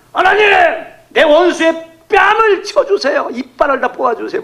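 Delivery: high, loud, pleading cry to God; preacher acting out a vengeful prayer; mock fierce prayer. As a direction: beg God for revenge, hammed up. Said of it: "막 이렇게 기도하는 거거든요".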